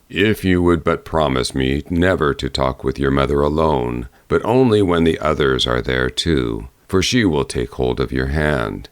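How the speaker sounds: low, grave bear voice; low bear warning. A narrator's reading. Bear warning the girl about her mother, low and grave.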